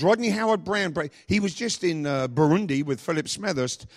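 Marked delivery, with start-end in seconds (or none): none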